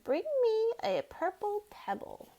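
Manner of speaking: slow, solemn lizard voice; the magic lizard answering the mouse, slow and solemn. Acted. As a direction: pronounce it like an ancient spell.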